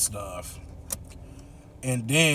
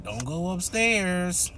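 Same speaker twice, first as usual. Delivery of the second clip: stern warning; 4 semitones higher than usual. Retold or imitated. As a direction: spell out the rule firmly. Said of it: "she's like".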